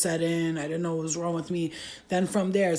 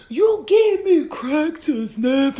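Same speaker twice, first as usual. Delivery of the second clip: outraged disbelief; 9 semitones higher, 8 dB louder, much slower than usual. Retold or imitated. Outraged at friend.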